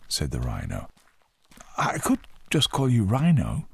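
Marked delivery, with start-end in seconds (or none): 1.8-3.7 put-on higher-pitched boy voice; young boy. A narrator's reading. Boy names his new friend.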